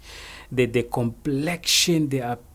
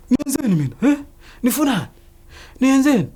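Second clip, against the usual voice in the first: high, loud, startled questioning; imitated alarmed protest; 13 semitones higher, 6 dB louder, much faster than usual. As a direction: protest like a man suddenly grabbed. Acts out the arrested man's protest.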